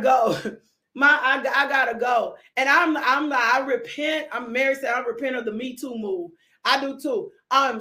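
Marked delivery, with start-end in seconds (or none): none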